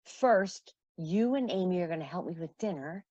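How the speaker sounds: ordinary talk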